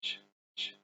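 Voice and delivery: "تش، تش" as bare voiceless "ch" bursts; isolated CH sound. Shown as a demonstration. Teacher showing how C and H sound, "تش" as bare "ch". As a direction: sound out "تش، تش" softly, with no vowel. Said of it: "سي والاتش بتنطق تش".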